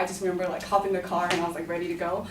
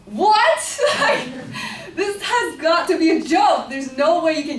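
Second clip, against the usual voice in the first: much higher and louder, laughing through it; telling of a third detention, laughing high and loud; laughing disbelief; 7 semitones higher, 6 dB louder than usual. Real, not acted.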